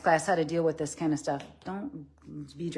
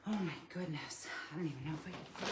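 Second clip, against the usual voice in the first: whispering; 3 semitones lower, 16 dB quieter, much faster than usual. Real, not acted.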